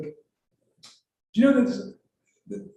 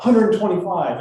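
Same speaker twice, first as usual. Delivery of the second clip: calling out higher and louder; raised-voice joke call; mock yell; 4 semitones higher, 7 dB louder than usual. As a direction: yell it out as the visitor trying a joke. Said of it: "And he yells out".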